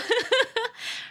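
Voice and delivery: short, high giggle; a quick, high laugh at her own joke. Real, not acted. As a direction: laugh at yourself. Laughs about her fiercer makeup.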